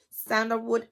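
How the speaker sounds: usual speaking voice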